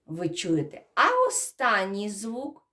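usual speaking voice

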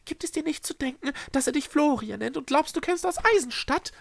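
high-pitched leading question; probing, lurking. A narrator's reading. Echo probes the Schreckse's doubts, lurking. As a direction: test her slyly in a high character voice. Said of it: "fragte er lauernd".

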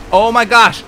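sudden loud shocked cry; startled exclamation. Real, not acted. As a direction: blurt it out, startled. Crashing while looking away.

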